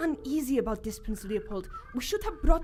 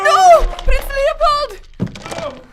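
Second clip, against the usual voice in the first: very loud, high, drawn-out scream; anguished scream; 13 semitones higher, 14 dB louder, much slower than usual. Acted. Manjula watching Prince Leopold fall, anguished drawn-out scream. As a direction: scream his name as he falls. He falls.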